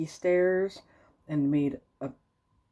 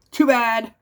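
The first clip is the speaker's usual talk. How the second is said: louder, higher dismissive retort; stink bug snap; 7 semitones higher, 9 dB louder than usual. A narrator's reading. Stink bug dismissing the bunnies, louder curt retort. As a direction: brush them off sharply.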